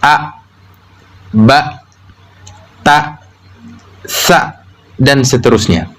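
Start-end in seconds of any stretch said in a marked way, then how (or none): 0.0-4.6 A, ba, ta, sa each cut off by a glottal stop; closed hamzah endings. Shown as a demonstration. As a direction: shut the vocal cords sharply after A, ba, ta, sa. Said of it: "dalam keadaan tertutup".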